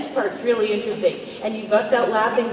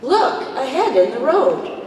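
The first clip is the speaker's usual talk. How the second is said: "Look" punched, then a break before "ahead in the road"; misplaced emphasis; 3 semitones higher, 4 dB louder than usual. Shown as a demonstration. Actress teases fans with a misread line, "Look" set apart. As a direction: split "Look" off from "ahead", as if spotting a head. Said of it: "could be misplayed two different ways".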